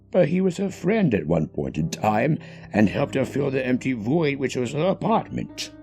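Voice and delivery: ordinary delivery, nothing marked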